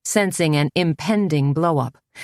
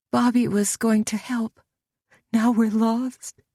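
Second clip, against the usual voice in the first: tearful; 7 semitones higher than usual. A narrator's reading. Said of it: "sobbed Lydia".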